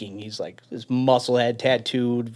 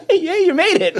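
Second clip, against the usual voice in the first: high, loud cheer through laughter; mock laughing cheer; 7 dB louder than usual. Instructor to trainee just pulled from the pool, laughing mock cheer. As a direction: imitate a laughing instructor crowing, pitched way up.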